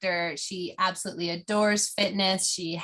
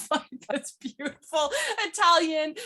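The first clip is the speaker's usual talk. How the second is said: laughing; 8 semitones higher than usual. Real, not acted. Laughs at her own accent attempt.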